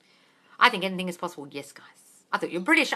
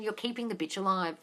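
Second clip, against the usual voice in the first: ordinary talk, nothing marked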